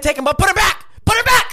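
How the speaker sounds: high, loud yelling at the world. Real, not acted. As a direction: yell it to everyone who takes pens. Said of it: "Who are you yelling at?".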